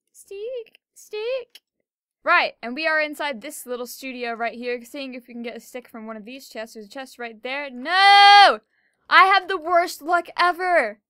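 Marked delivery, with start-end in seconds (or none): 0.1-1.6 tiny high-pitched voice; playful high squeal; squeaky plea. Pretend. 7.7-11.0 loud, high wail of dismay; dramatic drawn-out shout; frustrated yell. Real, not acted. Finds no stick in chest.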